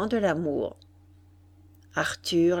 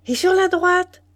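high, loud, rising voice; excited quick inquiry; eager questioning; 11 semitones higher, 7 dB louder than usual. A narrator's reading. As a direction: ask brightly, full of curiosity. Julie eagerly asks about view.

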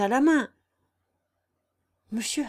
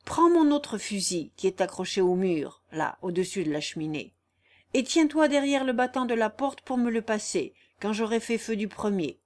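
ordinary delivery

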